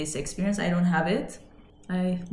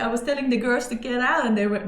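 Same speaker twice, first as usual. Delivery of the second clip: ordinary talk, nothing marked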